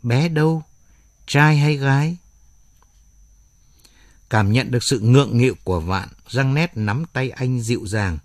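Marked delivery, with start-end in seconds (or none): 0.0-2.2 blurted awkward question. A narrator's reading. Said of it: "bật thốt ra câu hỏi để khỏa lấp sự vụng về".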